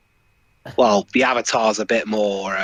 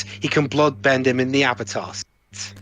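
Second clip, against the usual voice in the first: usual speaking voice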